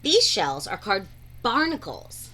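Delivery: plain reading voice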